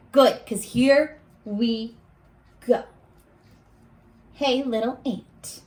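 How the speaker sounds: ordinary talk, nothing marked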